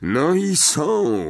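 bitter, grudging announcement. A narrator's reading. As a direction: draw it out resentfully. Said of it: "powiedział z goryczą".

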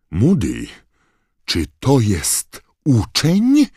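high, loud, slow incredulous question; McGonagall's shriek. A narrator's reading. McGonagall realises the ferret is a student, shrieking. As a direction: shriek it in disbelief, rising at the end. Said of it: "wrzasnęła profesor McGonagall".

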